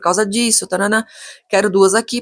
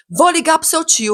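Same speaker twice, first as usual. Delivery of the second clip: high, slow-paced builder imitation; acting out worked-up indignation; retold agitated threat; 7 semitones higher, slower than usual. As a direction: mimic a flustered worker threatening to tell. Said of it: "ficou nervoso".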